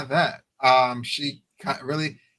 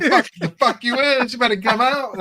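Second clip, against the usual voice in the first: higher, louder demand; mock indignant yelling; 9 semitones higher, 5 dB louder than usual. Fan shouting at a TV character, mock indignant. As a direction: yell at the screen to come out.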